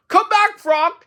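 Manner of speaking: very high, loud plea; desperate shout. Acted. Toad calls Frog back in his dream, high desperate shout. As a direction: shout for your friend to return. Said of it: "he shouted".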